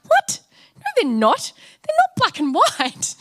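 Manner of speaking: shrieking high, loud and incredulous; shocked disbelief. Retold or imitated. Young Sharon protesting to Adam about the cows, shrill disbelief. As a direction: squeal it like you can't believe your eyes. City girl sees cows on hill.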